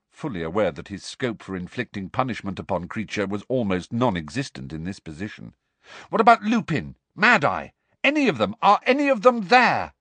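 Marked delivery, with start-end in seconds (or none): 6.1-9.9 high-pitched, pressing questions; urgent questioning. Acted. Harry asks the elf who is home, urgently. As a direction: press harder with each question, rising in pitch.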